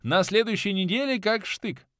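high, cheerful promise to the boss; brisk and eager. A narrator's reading. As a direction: report for duty with pep. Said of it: "бодро гаркнул я". (бодро, с готовностью)

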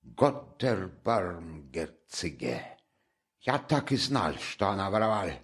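put-on soft, low German-accented exclamation; flustered German landlady. A narrator's reading. Amalia exclaims about Sonya stealing, soft German-tinged voice. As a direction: throw up your hands like a startled landlady. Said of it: "сплеснула руками Амалия Ивановна".

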